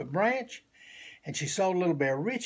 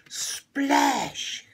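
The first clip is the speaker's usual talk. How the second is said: splash; 10 semitones higher, 4 dB louder than usual. Acted. Bear falls in river.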